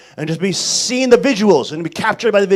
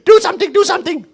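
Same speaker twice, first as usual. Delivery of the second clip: high, loud, fast mock nagging; nagging wife impression; 11 semitones higher, 5 dB louder, faster than usual. Imitating wife ragging on her husband, high nagging. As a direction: harp at him in a put-on high voice. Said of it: "rag on your husband all week".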